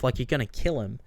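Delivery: ordinary talk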